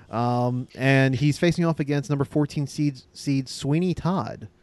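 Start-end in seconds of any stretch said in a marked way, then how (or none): none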